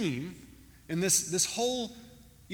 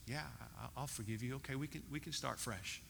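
soft, low, hesitant forgiveness; kinda shocked; 4 semitones lower, 12 dB quieter than usual. Retold or imitated. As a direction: act out a taken-aback, quiet reply. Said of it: "the woman's kinda shocked".

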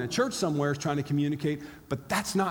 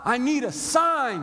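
high, raised-voice plea; acted yell to God; 7 semitones higher, 4 dB louder, slower than usual. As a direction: cry out to the heavens, acted. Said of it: "you yell out to God".

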